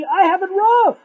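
high, loud acted complaint; indignant protest. Insulted man comparing his lot to rabbi's, indignant protest.

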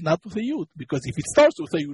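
ordinary delivery

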